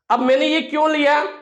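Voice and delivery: normal talking voice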